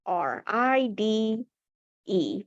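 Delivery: R I D E spelled out slowly letter by letter; slow spelling. Shown as a demonstration. Teacher slowly spelling ride for young students. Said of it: "let's spell it out".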